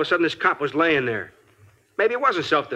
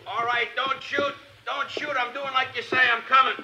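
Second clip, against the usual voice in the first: high, frightened plea not to shoot; pleading; 9 semitones higher, 4 dB quieter than usual. Acted. The suspect begs the cops not to shoot, high and frightened. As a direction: beg them not to fire.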